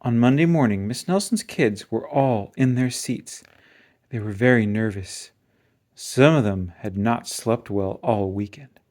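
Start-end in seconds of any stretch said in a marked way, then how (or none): none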